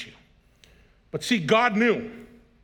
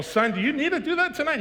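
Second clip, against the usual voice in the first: much higher, strained with laughter; mock pleading; 10 semitones higher than usual. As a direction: squeak it out, half laughing. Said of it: "And I go".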